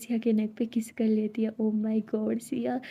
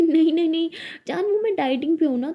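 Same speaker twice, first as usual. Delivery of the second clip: flustered denial; 6 semitones higher, 4 dB louder than usual. Acted. Tara hastily covers for dieting.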